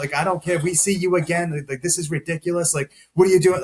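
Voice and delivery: high, half-shouted scolding; mock scolding the patient again; retold angry EMTs. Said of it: "they were mad".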